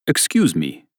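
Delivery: put-on polite German-accented call from behind; a stranger's German accent, politely; thick German accent. Stranger hailing priest from behind, thick German accent. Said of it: "said a man with a thick German accent".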